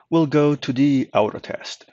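normal talking voice

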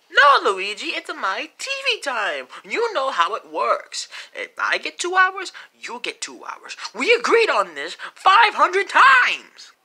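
high, loud, slow indignant rant; exasperated refusal. Acted. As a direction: hammer out the rules in outrage. Quarrel over TV time.

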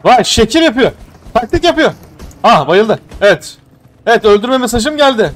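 high loud thrilled reactions; excited play-by-play. Real, not acted. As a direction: cheer the kill. Excited commentary in fight.

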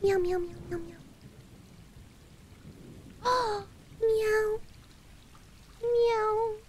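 soft little kitten mews; high-ish small cat voice; pretend cat meows. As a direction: gasp and mew tenderly. Meowing along with game cats.

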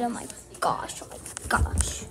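soft scared whisper. Real, not acted. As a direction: whisper it while sneaking downstairs. Creeping downstairs.

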